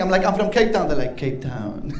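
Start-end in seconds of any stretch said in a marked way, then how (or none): 1.2-1.9 low, hushed mimicry of a South African accent; echoing his South African accent. Locals repeating the comedian's words, soft mock South African accent. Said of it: "repeating what I'm saying".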